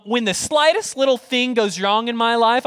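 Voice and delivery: normal talking voice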